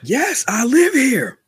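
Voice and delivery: high, loud mock yell; mock shout. Blurting a comic line to co-host, high shout. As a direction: belt it out high and drawn-out.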